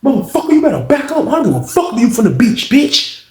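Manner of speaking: high, menacing yell; threatening yell. Retold or imitated. Unc warning the man from the beach, high threatening yell.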